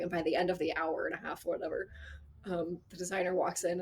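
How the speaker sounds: ordinary talk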